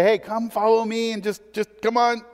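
wheedling invitation, half-hearted; mock pleading. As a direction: beg them to follow, lightly mocking. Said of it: "He doesn't say".

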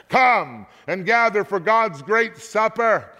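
high, carrying summons; calling aloud. A narrator's reading. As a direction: call out to birds across the sky. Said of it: "calling aloud to all the birds".

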